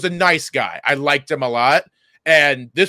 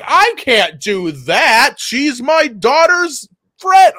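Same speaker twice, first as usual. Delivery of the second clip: mock heckler comeback; 10 semitones higher, 6 dB louder, much slower than usual. Acting out the Heckler Destroyer's comeback.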